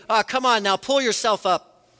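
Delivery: a dismissive, impatient scold, mildly played; mock dismissive God. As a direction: brush the sufferer off with a light scoff. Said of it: "or in heaven saying".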